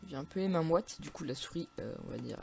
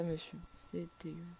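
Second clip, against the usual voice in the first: ordinary talk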